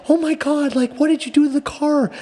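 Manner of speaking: high-pitched mock wail of dismay; crying mom. Mom to son over the stripped car, mock crying. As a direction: imitate a tearful mother, voice way up high. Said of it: "she literally started crying".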